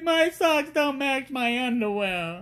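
put-on high, slow, whining child's complaint; whiny kid. Daughter to dad explaining her tears, whining. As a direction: sulk it out like a tearful little girl. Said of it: "She's like".

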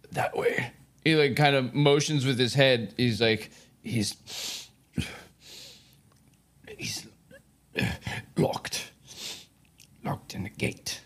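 faint, breathless whisper, fading out; dying whisper. Acted. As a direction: gasp the words with the last strength. Dying captive answers faintly.

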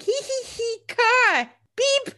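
very high, put-on childish giggle voice; mock a tiny kid thrilled by a car; giddy little boy. Imitating Matt playing with the car, childishly giddy.

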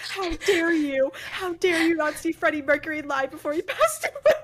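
play-angry at mom, half laughing; mock outrage. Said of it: "And I, like, got mad at her".

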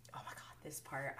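real whisper, overcome; hushed awe. Real, not acted. Recapper moved by the scene, whispering. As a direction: drop to a whisper as the scene hits you.

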